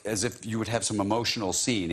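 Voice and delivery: usual speaking voice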